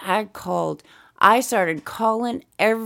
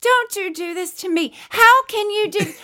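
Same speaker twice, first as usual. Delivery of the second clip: much higher, louder indignant pleading; outraged complaint; 12 semitones higher, 3 dB louder than usual. Retold or imitated. Regina calling the dealership bosses, outraged pleading. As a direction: demand answers from management, outraged. Retelling her phone complaints.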